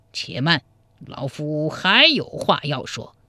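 put-on slow old player calling a halt; deliberate old man. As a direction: hold him up with a slow, drawn-out old man's voice. Narrator voices the old chess player. (老者慢条斯理地叫停)

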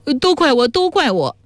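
high-pitched tearful self-blame; acted crying. A narrator's reading. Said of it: "魏汉哭道".

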